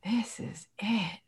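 slow, hushed whisper full of awe; grateful whisper. Shown as a demonstration. Waking up content in a small bed, whispered grateful awe. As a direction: breathe the words as if in reverence. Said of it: "you say".

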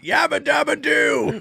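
high, slow, loud cartoon whoop; mock cartoon yell. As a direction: holler it like a cartoon caveman. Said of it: "interrupted with".

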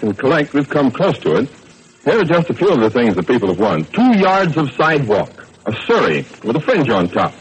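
showy, swooping announcer read; radio announcer pitch. Acted. Announcer telling Tracy fans about odd prizes, showy. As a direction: play up each prize for the listeners.

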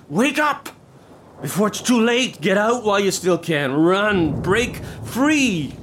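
high-pitched desperate pleading; urgent drunken plea. Acted. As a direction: urge her to run, almost frantic. Drunk urging Elena to flee.

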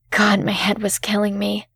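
ordinary delivery, nothing marked

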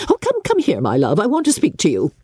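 high coaxing call; coaxing mother. A narrator's reading. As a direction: beckon the girl out of the room. Mother beckons Kitty away.

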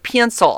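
"Pencil" said as "pee-in-sil". Retold or imitated. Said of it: "And he'd say, like".

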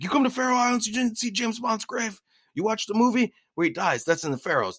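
high, incredulous imitation of a Faroese local; thick Faroese accent. Faroese local to tourists about Bond's grave, incredulous Faroese accent. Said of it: "The accent was too thick".

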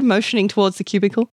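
ordinary delivery, nothing marked

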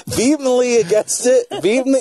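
high, laughing stumble on "Vehemently". Real, not acted. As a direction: fight through giggles on "Vehemently". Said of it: "Ah, I can't talk".

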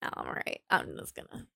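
breathy whisper, talking herself down; whispered self-calming. Retold or imitated. Calming herself down in a whisper instead of yelling. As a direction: whisper it to yourself, staying calm. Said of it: "And now I'm like".

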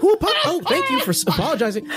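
high, pleasantly confused guard; baffled guard. Acted. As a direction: thank someone you can't see. Guard voiced by GM.

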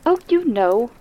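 a touch of impatience; impatient. Acted. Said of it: "Missus Delacourt said impatiently".

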